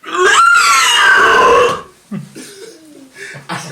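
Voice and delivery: high, loud screeching dinosaur cry; pterodactyl shriek. Shown as a demonstration. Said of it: "do an impression of a pterodactyl".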